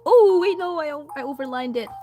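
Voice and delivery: ordinary talk, nothing marked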